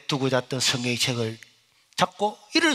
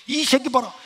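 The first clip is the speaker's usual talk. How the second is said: irritated teacher; 7 semitones higher, 4 dB louder than usual. Retold or imitated. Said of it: "선생님이 열받아가".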